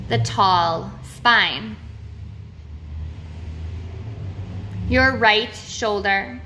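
slow, measured meditation voice with long pauses; name each body part slowly, leaving space after it; guided body-scan meditation. Real, not acted. Guide leading listeners through a body awareness meditation, slow and measured.

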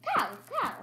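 high mimicking cow, cow; pet impression. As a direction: copy the pet's squeaky cry. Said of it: "You do a great impression, [name]".

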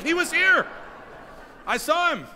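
high-pitched, loud, indignant insistence; protesting shout. Retold or imitated. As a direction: shout it in disbelief, desperate to be believed. Protests to disbelieving kids about possum.

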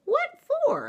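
very high, loud questioning mama; astonished. Acted. Mama asking why brother took the ladder, astonished. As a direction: shoot the question way up in pitch.

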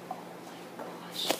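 barely voiced, very soft amazement; awed whisper. Real, not acted. Vlogger overwhelmed by the scented squishy, awed whisper. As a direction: breathe it out in a whisper.

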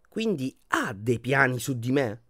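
ordinary delivery, nothing marked

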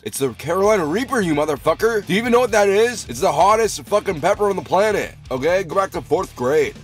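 high, loud outraged scolding; indignant rant. Real, not acted. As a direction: berate a clueless doubter. Scolding doubting viewers.

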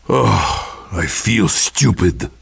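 put-on very low, dejected Russian-accented grumble; dejected groan. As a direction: sink right down low, feeling foolish. Soldier downed after soiling his trousers.

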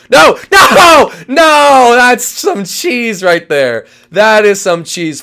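loud, high drawn-out protest; outraged yelling. Real, not acted. Player protesting a cheap move, loud outrage. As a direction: cry foul at full volume, stretching the words. Protests being found unfairly.

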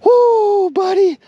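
high loud cheer; joyful whoop. Real, not acted. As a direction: whoop at the catch. Cheering the fish.

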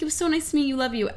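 high, starstruck farewell; a fan saying goodbye to her idol, gushing. Retold or imitated. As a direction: gush it like a dazzled fan. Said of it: "and I was like".

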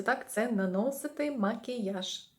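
normal talking voice

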